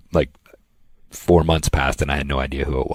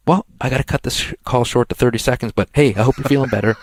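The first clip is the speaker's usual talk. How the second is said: imitated caller hurrying off, higher; Lenny Lane; 8 semitones higher than usual. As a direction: wrap up the call in a put-on higher voice. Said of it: "And he hangs up".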